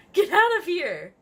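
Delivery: loud, high angry yell. A narrator's reading. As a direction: yell it at the others. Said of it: "yelled a bloody body".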